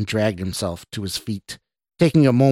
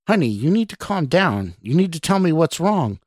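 higher, lightly voiced pleading woman; confused woman; 7 semitones higher than usual. A narrator's reading. Woman begs Scott to explain himself, confused. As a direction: urge him to calm down in a higher voice. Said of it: "her tone one of confusion".

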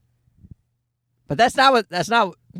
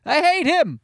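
put-on a high, far-off shrieking baby voice, much higher and shouted; baby screaming; slower than usual. Dad voices his screaming baby who hates him, high shout. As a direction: squeal it out like an outraged newborn. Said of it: "he starts screaming".

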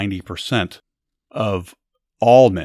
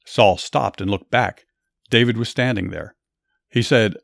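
steady, lively Bible storytelling; storyteller voice, slightly raised; story narration; 2 semitones higher, 4 dB louder than usual. A narrator's reading. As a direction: tell it like a story to a listener.

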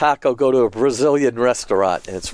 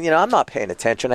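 ordinary delivery

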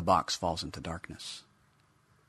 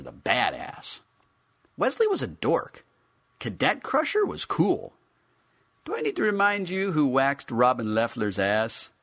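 cocky action figure, higher and swaggering; brash Cadet Crusher; 4 semitones higher, 4 dB louder than usual. A narrator's reading. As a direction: brag like a teenage badass toy. Action figure brags about himself.

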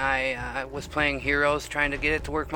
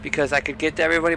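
normal talking voice

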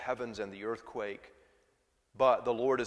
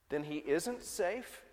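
Lucy, timid; 5 semitones higher, 7 dB quieter, slower than usual. Acted. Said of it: "said Lucy".

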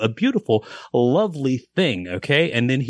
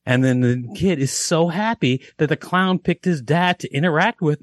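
ordinary delivery

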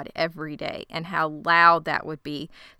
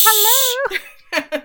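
much higher, cartoonish greeting; silly woman; 5 dB louder than usual. Cohost imitating the woman calling out. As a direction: chirp it like a clueless lady. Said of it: "She's like".

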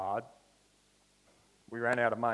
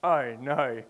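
dismayed oh no; 3 semitones higher, 10 dB louder than usual. Retold or imitated. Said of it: "I'm like".

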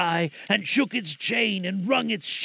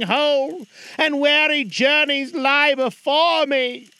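unearthly voice; 8 semitones higher, 6 dB louder than usual. Acted. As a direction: drag each word like a chain. Ghost speaks.

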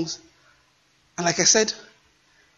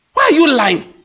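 loud, high, indignant demand; indignant shout; 7 semitones higher, 7 dB louder than usual. Real, not acted. Preacher rebuking false teachers, indignant loud demand. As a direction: fire the question at them like an accusation.

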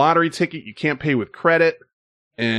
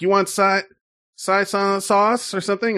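raised-pitch imitation of the bartender; Tien's voice; 8 semitones higher than usual. Bartender on the phone asks about an order, higher imitated voice. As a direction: lift the voice well up to mimic Tien. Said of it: "that's what he sounds like".

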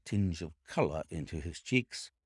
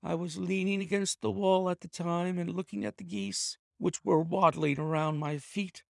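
higher, nervous confession; cowed clerk owning up; frightened thief confessing; 11 semitones higher, faster than usual. A narrator's reading. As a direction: confess fast before he changes his mind. Ryder narrates his confession.

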